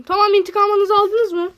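"aldınız" vurgulu, yüksek ve tiz "intikamınızı aldınız mı?"; "intikamınızı aldınız mı?" diye yüksek sesle, heyecanla sor. Shown as a demonstration. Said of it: "Böyle dedim". (coşkulu, yüksek sesli soru)

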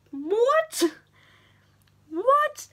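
high, loud double what; shrieked incredulous what; shocked disbelief. Retold or imitated. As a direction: gasp out the words in disbelief.

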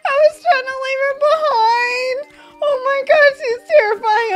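high, loud panicked outburst; terrified. Real, not acted. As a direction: blurt it out high, in alarm. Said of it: "I'm so terrified right now".

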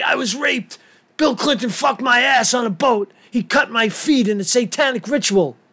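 high-pitched, hammed-up victim impression; mock accuser voice. Host mockingly voices an accuser he disbelieves, high and hammed-up. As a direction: put on a mocking, high complaining voice.

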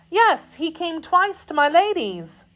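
high-pitched maid's voice; anxious maid. Acted. Kitty reporting the man's visits, high and anxious.

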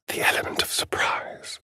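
hushed sneaky whisper; conspiratorial whisper. Acted. Vampire planning to sneak past a mob, whispered.

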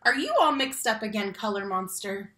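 ordinary delivery

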